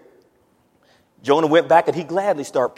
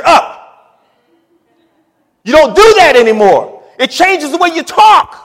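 high, loud sermon exhortation; full-force preaching; 9 semitones higher, 10 dB louder than usual. Real, not acted. Preacher telling believers that belief changes them, full-force preaching. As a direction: drive it home at full voice.

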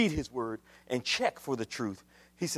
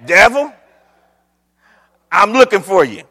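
loud, rising calls to the devil; mocking, spaced-out summons; mock TV preacher; 3 semitones higher, 12 dB louder than usual. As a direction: imitate a preacher calling out a challenge. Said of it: "preacher on T V talking about".

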